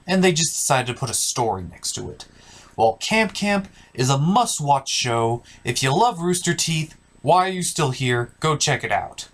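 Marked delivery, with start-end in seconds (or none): none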